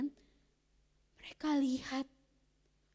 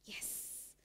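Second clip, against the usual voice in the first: unvoiced, secret little cheer; whispered yes; 18 dB quieter than usual. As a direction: whisper the triumph to yourself. Boy gloats to himself.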